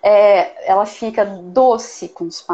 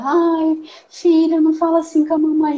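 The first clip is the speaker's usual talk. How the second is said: higher, sweet pleading voice, a bit fast; mock pleading mom; 7 semitones higher than usual. Shown as a demonstration. Mother meekly begging her daughter to stop, higher and sweet. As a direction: plead weakly like a mom with no firmness at all. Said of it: "com uma fala".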